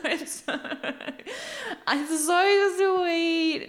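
slow swooning gush over the proposal; drawn-out gushing. Acted.

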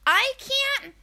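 mocking high-pitched persona voice; Drunk Jen. Podcaster mimicking a drunk reality star, mocking high voice. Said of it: "the persona Drunk Jen puts out has this voice".

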